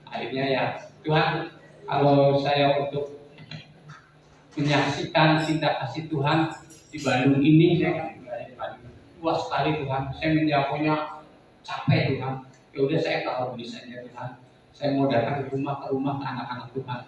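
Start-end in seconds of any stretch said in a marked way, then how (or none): none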